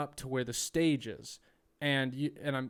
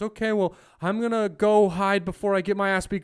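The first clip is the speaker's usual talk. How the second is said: mock nervous comic; 6 semitones higher, 10 dB louder than usual. Quoting comic's exit line.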